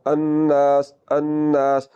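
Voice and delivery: long nasal n, long aa in "अन्नास"; ghunna. Shown as a demonstration. Teacher showing noon mushaddad, held nasal hum. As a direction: hold the nn of "अन्नास" in the nose. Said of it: "इसमें गुन्ना होगा".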